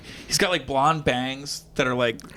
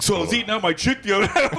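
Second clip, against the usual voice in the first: mock hack comic; 6 semitones higher, faster than usual. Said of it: "They would go out there and literally be like".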